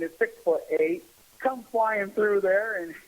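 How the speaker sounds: ordinary delivery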